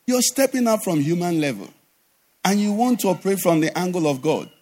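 normal talking voice

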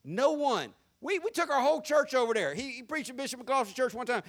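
ordinary talk

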